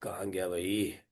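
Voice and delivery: low, soft, level self-talk; muttering to oneself. Real, not acted. Teacher to self while deleting unwanted things, muttering low. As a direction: murmur as if busy with a task.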